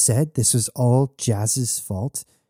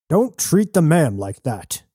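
higher-pitched protest from behind; mild narrated shout; 5 semitones higher than usual. A narrator's reading. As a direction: call it out, without full force. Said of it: "someone shouted from behind them".

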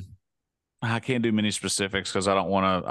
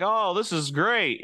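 slow, higher-pitched polite delight; faked excitement; 8 semitones higher, slower than usual. Gift receiver pretending to like an attachment, politely excited. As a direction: draw out put-on pleasure. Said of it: "a story of you faked excitement".

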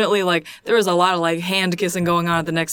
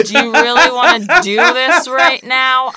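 slow, louder playful threat; mock challenge; 4 semitones higher, 7 dB louder, slower than usual. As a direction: drag it out like a dare. Repeats the challenge, louder, laughing.

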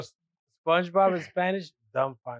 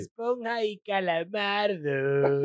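drawn-out Spanish names; 3 semitones higher, slower than usual. Retold or imitated. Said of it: "the way they say it is dumb funny".

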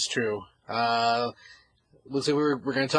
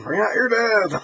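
high called-out cart man; plague cart call; 10 semitones higher, 6 dB louder, slower than usual. Retold or imitated. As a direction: call it while ringing a bell. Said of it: "Ringing a bell outside, dragging a sled".

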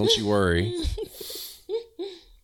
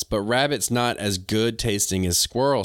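ordinary talk

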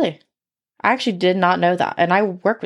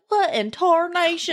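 higher Southern twang; 7 semitones higher than usual. Acted. Host voices baffled hunters in a truck, Southern accent. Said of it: "and they're like".

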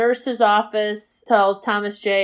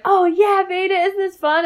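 higher, sing-song forced cheer; chirpy mom voice; 9 semitones higher than usual. Retold or imitated. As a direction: play the upbeat mother hiding nerves. Mother figure quoted at bumper cars.

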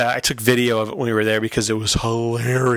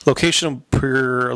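ordinary talk, nothing marked

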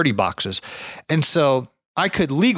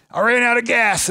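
put-on higher old man's voice with ran stressed; imitating old man; 10 semitones higher than usual. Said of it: "And he's like".

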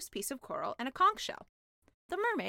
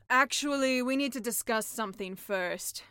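louder, serious sea witch; 5 dB louder than usual. Acted. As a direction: break the news as the witch. Sea witch voiced in story.

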